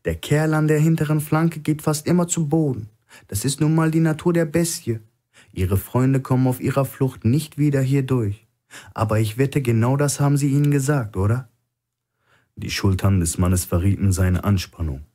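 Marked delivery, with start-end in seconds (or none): 0.0-11.5 higher, cool and knowing, quickening at the end; a captive calmly needling his guard; lightly raised voice of a sly hostage; Stone, calm hostage. Acted.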